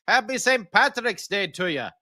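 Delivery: put-on a cheesy Irish accent for the holiday greeting; bad Irish accent. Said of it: "really bad Irish accent right there".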